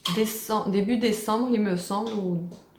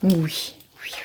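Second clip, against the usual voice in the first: whisper; 4 semitones lower than usual. Real, not acted. Whispering to the pet.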